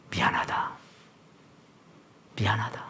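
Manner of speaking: whispered, barely voiced sorry; 속삭이듯 미안하다; whispered apology. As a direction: breathe the apology almost silently. God's apology fading to whisper.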